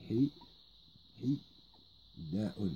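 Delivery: Hi, hi, da'un split into separate beats; syllable by syllable. Shown as a demonstration. Teacher splitting Hida'un for learners, syllable by syllable. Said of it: "La prononciation, c'est comme ça".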